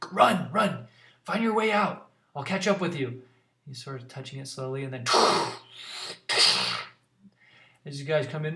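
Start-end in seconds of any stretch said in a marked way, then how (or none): 0.1-3.2 higher, louder commanding; a leader sending his friend to flee; Ballister urging. Acted. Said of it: "he's like".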